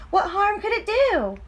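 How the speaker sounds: high coaxing pigtail voice; urging pigtail. Acted. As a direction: coax him into one little tug. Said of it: "urged the pigtail".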